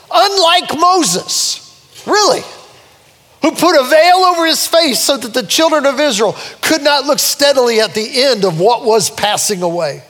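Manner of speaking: high-pitched, ringing scripture proclamation; full-force preaching. Real, not acted. Preacher reads about Moses to congregation, full-force preaching. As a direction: launch into the verse high, easing down at the end.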